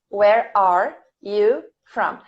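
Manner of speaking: slow, word-by-word dictation; each word set apart for students to write. Shown as a demonstration. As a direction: dictate it slowly, pausing between the words. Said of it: "This is dictation".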